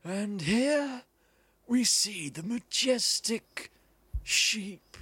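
slow, hushed-awe wildlife narrator with long pauses; David Attenborough. Acted. Game player doing a wildlife presenter impression, slow documentary narration. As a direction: present the animal like a grand nature documentary, drawn out. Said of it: "Like, Sir Actor Sir David Attenborough".

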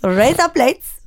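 put-on Indian accent. Shown as a demonstration. Showing co-host the better version, Indian accent. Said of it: "if you say it in an Indian accent".

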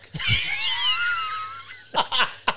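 loud, very high-pitched laugh. Real, not acted. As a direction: burst out in a long high laugh. Laughs at the punchline.

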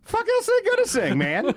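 high-pitched comic disbelief; mock-baffled concertgoer, voice shot way up; incredulous. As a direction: blurt it high, breaking into a laugh. Mocks the one-hit band's show.

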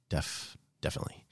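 breathy whisper. Real, not acted. Whispered repeat.

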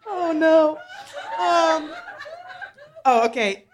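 giggling through the words; nervous laughing. Real, not acted. Laughing at own nerves.

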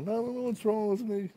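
high, teary voice breaking on wrong; mock crying. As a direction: sob it out like a broken man. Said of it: "And he starts crying".

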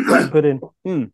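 talking with a mouthful; words around a bite of food; mouth full. Real, not acted. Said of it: "I've got my mouth full".

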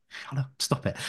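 whispered telling-off; hushed whisper. Retold or imitated. Host shushing the listening smart speaker, hushed whisper. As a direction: hiss it under your breath, voiceless. Hushing so the device won't hear.

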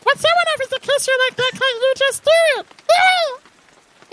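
very high squeaky scolding bat. Acted. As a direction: shriek it like a tiny bat.

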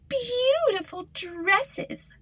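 very high, slow, drawn-out spoiled voice; greedy stepsister. Acted. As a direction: stretch it out like a spoiled girl. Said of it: "said one".

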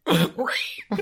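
high squealing pig snort; snorting oinking pig. Pretend. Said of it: "Is that good?".

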